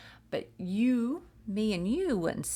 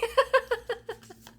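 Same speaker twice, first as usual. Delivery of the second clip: loud high burst of laughter; 6 dB louder than usual. Real, not acted. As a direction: crack up at your own joke. Laughing at own joke.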